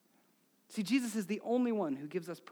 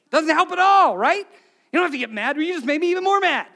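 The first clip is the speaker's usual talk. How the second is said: loud, high, mad retort; acted exasperation; 8 semitones higher, 13 dB louder than usual. Fired-up spouse answers being told to calm down, acted anger. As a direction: build to an indignant yell. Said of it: "you're getting mad".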